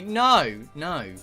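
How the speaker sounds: ordinary talk, nothing marked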